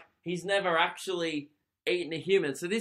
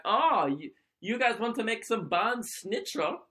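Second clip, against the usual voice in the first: put-on higher-pitched, hammy German accent; bad German accent; 7 semitones higher than usual. Podcaster imagining an eager German volunteer, bad German accent. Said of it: "not a good German accent".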